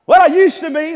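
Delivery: loud, high mock reply; mock churchgoer. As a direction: blurt it like a confused church member. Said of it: "imagined reply from doubter".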